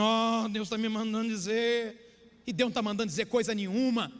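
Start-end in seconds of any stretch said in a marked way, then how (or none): 0.0-2.4 slow, droning fake revelation; imitating a pretend prophetic trance; mock false prophet. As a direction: stretch it out like a phony seer. Imitates deceivers claiming divine message.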